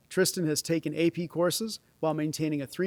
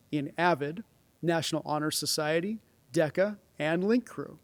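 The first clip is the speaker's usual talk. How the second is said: ordinary talk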